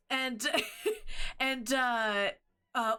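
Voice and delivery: usual speaking voice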